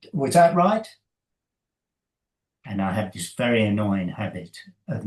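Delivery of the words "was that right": high, louder eager question; anxious swimmer. Retold or imitated. Swimmer at the lane end asks the coach, high and eager. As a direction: ask hopefully, wanting approval.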